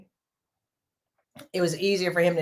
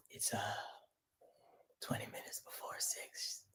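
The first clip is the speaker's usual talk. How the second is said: hushed, whispered imitation of husband; mimicking husband; 24 dB quieter, faster than usual. Wife teasing husband about being on time, mimicking him in a whisper. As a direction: whisper it as a mock quote of him. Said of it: "Why do I have to sound like that?".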